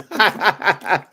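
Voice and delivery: quick run of chuckles; hearty laugh. Real, not acted. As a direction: laugh in a rapid string. Amused by own remark.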